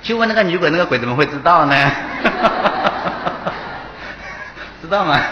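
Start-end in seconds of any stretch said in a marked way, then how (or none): 1.8-4.8 breathy laugh rising higher. Real, not acted. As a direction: laugh as the riddle lands. Laughs at the ghost riddle. (一阵上扬的笑声)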